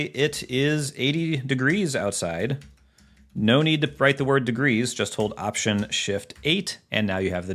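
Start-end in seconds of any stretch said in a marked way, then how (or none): 0.0-2.6 example sentence. Shown as a demonstration. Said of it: "if you ever wanted to say".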